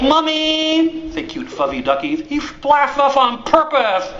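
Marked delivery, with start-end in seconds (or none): 0.1-1.1 high whiny call to mom; whiny kid tattling. Acted. Ducklings tattle to mother. 2.3-4.1 high, slow whiny complaint; whiny kid tattling. Acted. Ducklings tattle to mother.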